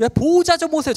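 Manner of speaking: high, loud mock yell for the guardian; yelling father. Father yelling for his guardian in the ER. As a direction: call out across the ward.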